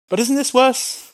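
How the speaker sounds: up-pitched worried question; incredulous objection. Retold or imitated. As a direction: voice Habakkuk's alarmed protest. Habakkuk objecting to God.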